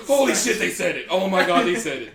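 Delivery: loud, high, hammed-up amazement; mock excited moviegoer. Moviegoer hearing the film's title said, mock-ecstatic outburst. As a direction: exclaim like a thrilled fan, laughing.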